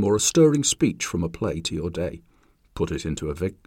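ordinary talk

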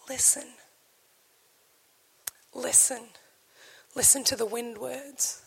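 very soft, almost breathed; hushed urging. Acted. Jesus telling the church to listen, hushed. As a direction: bring it down to a near hush.

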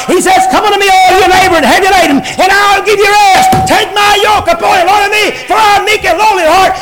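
full-force Southern preacher cadence; preaching. Acted. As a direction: preach it hard to the congregation.

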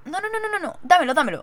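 excited pleading no no no; eager refusal. Retold or imitated. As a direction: grab it before he takes it back. Eager to grab the McFlurry.

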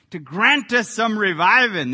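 loud Southern Baptist preacher drawl. Shown as a demonstration. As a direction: drop the g with a Southern twang. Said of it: "I got to say it with that Southern drawl".

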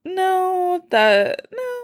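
high, held-out mock "no"; retold refusal. Podcaster rejecting their own tune idea, mock high-pitched refusal. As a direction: draw it out high, dismissing the idea. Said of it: "and then I was like".

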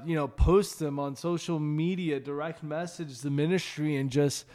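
rally the listeners to share their stories; rising preaching fervor. Real, not acted.

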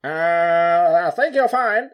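retry "I think you're fine" with the voice pushed further; Nelson Mandela impression. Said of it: "I'm gonna do it again better".

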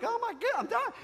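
high-pitched comic dying wail; mock panic. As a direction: wail it high, hamming up the fear. Acted panic on the gurney.

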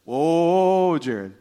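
Holy Spirit. Retold or imitated. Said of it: "the Holy Spirit just said".

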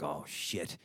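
low, hushed swear under the breath; muted dread. Retold or imitated. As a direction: sink into a quiet, worried aside. Retold dread on entering risky block.

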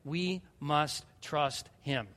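slow, low "We must trust him", each word set apart; emphatic repetition. Real, not acted. Pastor repeating his point to the congregation, slow soft emphasis on "We must trust him". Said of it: "I've gotta say it over and over".

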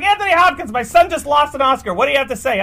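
high, loud mom confronting a star; demanding mom. Retold or imitated. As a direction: demand an answer like a proud mother. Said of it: "she's like".

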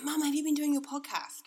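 put-on high, loud, thrilled kid's question; excited child. Eldest son home from school asks mum about her podcast, excited. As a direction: burst out with an eager, high-pitched question. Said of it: "he was so excited".

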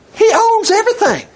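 very high-pitched, amazed outburst; excited exclamation. Acted. Gleeful exclamation about rich Boaz.

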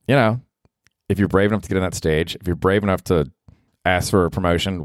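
normal talking voice

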